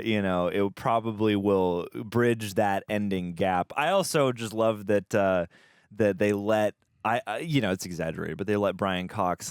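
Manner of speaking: ordinary talk, nothing marked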